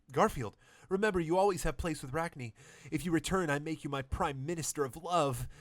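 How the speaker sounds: lightly voiced alien queen saying goodbye; lonesome queen. Rachni Queen farewell voice.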